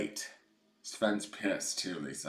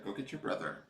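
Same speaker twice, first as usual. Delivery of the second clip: normal talking voice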